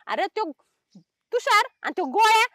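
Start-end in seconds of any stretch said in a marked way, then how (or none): 1.3-2.4 रागावून सुनावणे. Acted. कामचुकारपणावर रागावते.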